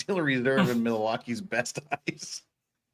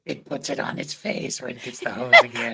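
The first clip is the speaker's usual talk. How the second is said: higher unvoiced creepy whisper; creepy whispered quote; 9 semitones higher than usual. Quoting a creepy line about the lawyer, whispered high. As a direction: whisper it like a menacing captor. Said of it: "When did Gollum become a lawyer?".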